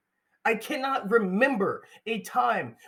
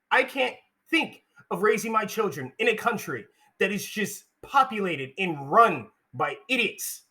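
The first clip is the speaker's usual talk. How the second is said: ordinary talk, nothing marked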